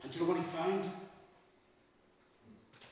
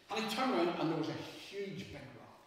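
ordinary delivery, nothing marked

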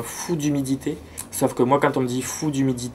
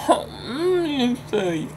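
delighted surprise; 11 semitones higher, slower than usual. Real, not acted. Spots a new leaf, delighted.

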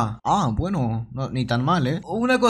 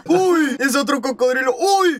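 high-pitched yelp of alarm; real startled cry, pitched high; 7 semitones higher, slower than usual. Real, not acted. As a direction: yelp at a sudden threat. Spots another crocodile.

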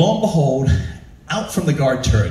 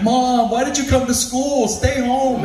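put-on embarrassed kid whine; 12 semitones higher, slower than usual.

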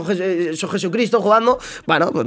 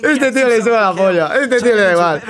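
laughing amazement. Real, not acted. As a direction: marvel at the player, still laughing. Marvelling at player while laughing. (asombro entre risas)

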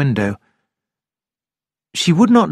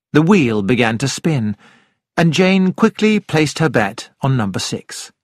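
ordinary delivery, nothing marked

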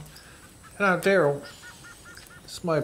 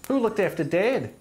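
raised, furious voice; angry shouting; 9 semitones higher, 3 dB louder than usual. Acted. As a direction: yell at your brother. Sibling quarrel, shouting.

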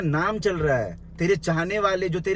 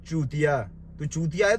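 ordinary delivery

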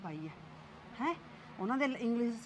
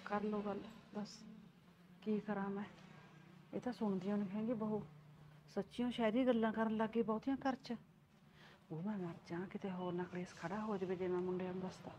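muttered aside; 2 semitones lower, 9 dB quieter than usual. Acted. Mother grumbles to herself alone.

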